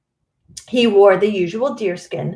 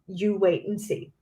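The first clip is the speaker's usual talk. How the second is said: ordinary delivery, nothing marked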